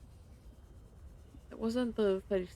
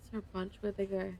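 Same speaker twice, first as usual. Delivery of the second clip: usual speaking voice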